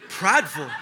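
low, falling echo of the accusation on "Prideful"; taken aback. Retold or imitated. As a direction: repeat "Prideful" in low disbelief. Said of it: "That's what I said".

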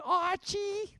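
very high-pitched mock wife voice; wife impression. Imitating the TV wife calling her husband, high-pitched. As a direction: call out his name in a high, put-on wifely voice. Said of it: "That's the way she talked".

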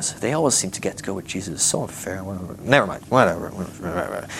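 low, soft grumble trailing into a mumble; sulky aside, sinking to a murmur; disgruntled muttering. Acted. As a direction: grumble under your breath, fading away. Left-out disciples grumble to themselves.

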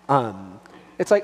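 ordinary talk, nothing marked

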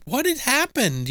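high, slow curious question; curious girl. Retold or imitated. Karen asking the janitor what happened, high and slow. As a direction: ask gently and wide-eyed. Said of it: "was like".

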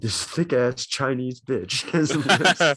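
pleasant hard sell ad read; infomercial voice. Retold or imitated. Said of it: "in the most pleasant, like, infomercial voice".

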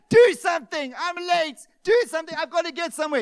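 loud, high mock outburst; hammed-up furious customer; frustrated shout. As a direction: yell at the staff to hurry. Raging at bank staff.

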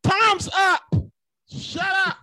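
loud shouted cutoff; shouting. Acted. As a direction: yell time's up. Moderator cutting off debaters.

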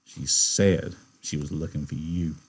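low, slow, quiet taunt; insulting. A narrator's reading. George taunting angry Curly about the girl, insultingly. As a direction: needle him slowly, dropped low and soft. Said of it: "He said insultingly".

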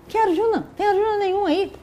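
high, scoffing retort; exasperated dismissal. Acted. Radeya fed up with hearing Arjuna's name, exasperated.

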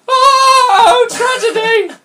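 very high, loud, sung-out lament; mock tragic wail. Reader mocking the alpha's arrival, shrill theatrical wail. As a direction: wail it like a melodrama queen.

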